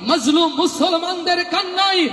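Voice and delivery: high, fervent oration; impassioned preaching. Acted. Preacher exhorting the Muslims of Bengal, fervent and high. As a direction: rouse the listeners with rising fervour.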